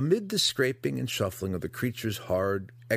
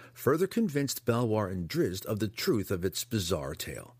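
ordinary delivery, nothing marked

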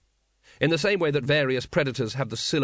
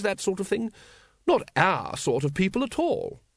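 airy; 9 semitones higher than usual. A narrator's reading. Lady Ramkin listing the kings' bad habits, airily. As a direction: list their faults breezily in a high voice. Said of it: "she said airily".

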